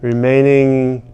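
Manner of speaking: emphatic affirmation lead. Shown as a demonstration. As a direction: chant each phrase firmly for them to repeat. Leads call-and-response tapping affirmations.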